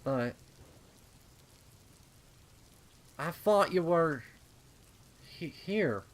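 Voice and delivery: dawning dread. Acted. Realizes the thing isn't her sister.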